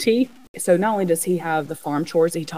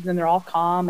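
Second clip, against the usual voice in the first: usual speaking voice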